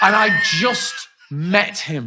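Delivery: loud, slow, disgusted exclamation; outraged shout. Real, not acted. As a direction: hammer out the disgust. Outraged at friend's gross act.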